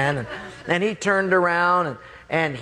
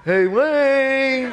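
high, loud sing-out through a door; pestering late-night caller; Mike; 10 semitones higher, 5 dB louder than usual. Retold or imitated. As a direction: call out with a long, drawn-out last word. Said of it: "I hear this voice".

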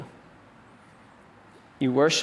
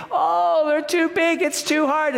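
hammed-up fearful whine; whining grumblers; 11 semitones higher than usual. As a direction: whine it like frightened, grumbling people. Israelites grumbling after the spies.

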